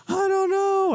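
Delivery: high, wailing "crying" voice; mock bawling. Mother re-enacting her answer to dad, mock bawling. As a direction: wail it like a hammed-up sob, pitch way up. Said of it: "And I'm bawling".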